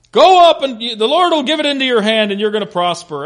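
loud, high overblown false promise; dripping sarcasm. Acted. Micaiah parroting the prophets to the king, sarcastic. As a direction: oversell the victory, mocking it. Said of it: "this thing is dripping with sarcasm".

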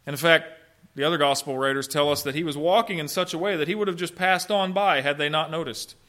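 ordinary talk

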